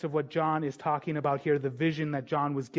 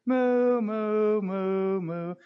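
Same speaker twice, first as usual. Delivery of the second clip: cow mooing; 3 semitones higher, 3 dB louder, much slower than usual. Pretend. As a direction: moo like a cow, dying away. Cow noise in nativity song.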